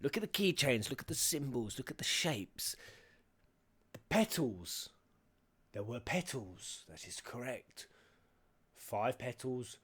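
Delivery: ordinary talk, nothing marked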